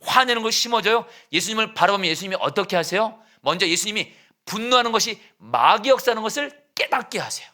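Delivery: loud, high preaching; fiery preaching. Acted. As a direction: preach it out with full force. Preaching at full force.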